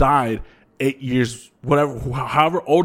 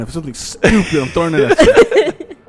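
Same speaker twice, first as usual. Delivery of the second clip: laughing threat; 5 semitones higher, 8 dB louder than usual. Real, not acted. Jokingly threatens with a marker.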